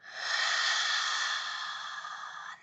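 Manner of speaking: long, drawn-out sigh; slow, deep breath. Shown as a demonstration. Therapist modeling breathing slow and deep, long sigh. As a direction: let a long breath all the way out. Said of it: "slow and deep".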